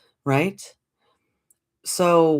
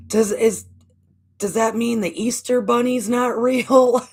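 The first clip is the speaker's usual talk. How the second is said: put-on high, louder little-kid plea; devastated child; 8 semitones higher, 4 dB louder than usual. Daughter asking her mother through sobs, high and pleading. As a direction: ask like a crushed child mid-tears. Sobbing child asks about Easter bunny.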